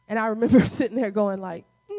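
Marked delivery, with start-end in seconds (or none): 1.9-2.0 high, drawn-out mock no; doubtful refusal. Preacher replaying his answer to God, high and unconvinced. Retold doubt at God's word.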